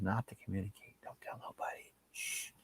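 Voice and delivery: very soft, whispered and shushing; hushed secret. Pretend. Telling the chat to keep a secret, whispered.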